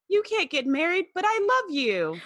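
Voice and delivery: high, pleading mock lover; high mock lovesick. Riker mockingly voiced pleading high and whiny. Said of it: "suddenly he's like".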